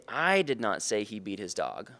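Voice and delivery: "I" leaned on hard and drawn out, rest falling away; stress on I. Shown as a demonstration. Sales trainer showing how emphasis shifts meaning, stress on "I". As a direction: slowly, punch the opening "I". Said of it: "depending on which word I emphasize".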